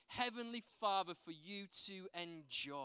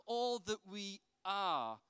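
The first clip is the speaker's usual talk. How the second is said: ordinary talk, nothing marked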